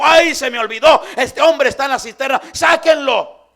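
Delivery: loud, high shouted command; a king suddenly remembering, yelling to his men; the king ordering Jeremías pulled out, shouting; startled king's order. Acted. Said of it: "se acordó el rey ... y dijo".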